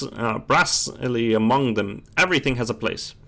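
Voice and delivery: ordinary talk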